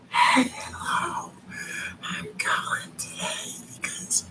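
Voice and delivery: barely audible whisper, mocking the caller; whispered caller imitation. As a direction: mimic a caller who whispers. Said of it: "She couldn't have spoke any quieter".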